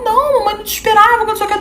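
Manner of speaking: high-pitched mock-innocent mother; covering up. Mother hiding something from daughter, feigned casualness. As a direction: imitate mom playing it cool. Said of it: "deu uma disfarçada".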